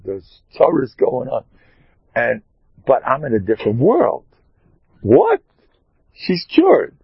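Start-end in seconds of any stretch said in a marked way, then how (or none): 5.0-7.0 high, loud burst of amazement; a hammed-up gasp of disbelief; sudden surprise. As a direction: pop up in mock astonishment. Mock astonishment at instant cure.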